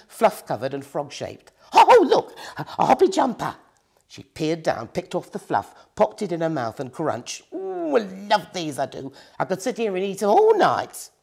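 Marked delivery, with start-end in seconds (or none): 1.7-3.6 high loud delighted squeal; thrilled at finding a sweet; glad little cry. A narrator's reading. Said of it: "with a glad little cry". 7.5-11.1 a touch of greedy bliss; relishing. A narrator's reading. Witch savouring sweet.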